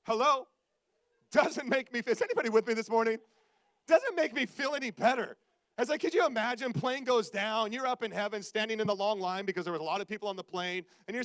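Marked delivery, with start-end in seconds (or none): 0.0-5.4 loud, comic exasperation; loud mock protest to the room; exasperated calling out. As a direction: call out to the crowd for backup. Mock outburst, calling on the congregation.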